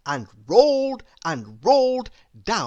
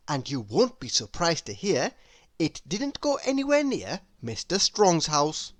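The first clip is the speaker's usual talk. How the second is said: ordinary delivery, nothing marked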